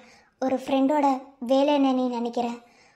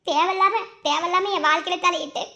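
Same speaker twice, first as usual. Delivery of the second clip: high, loud sobbing, building to a wail; loud tearful pleading with her mother; crying plea; 6 semitones higher, 4 dB louder than usual. Acted. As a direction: beg desperately through sobs.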